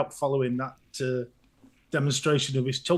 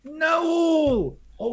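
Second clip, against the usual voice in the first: calling out; 11 semitones higher, 4 dB louder, slower than usual. Real, not acted. Calling out greetings.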